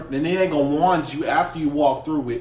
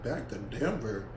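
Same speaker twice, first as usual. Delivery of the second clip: shocked; 5 semitones lower, 11 dB quieter than usual. Retold or imitated. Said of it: "I was like".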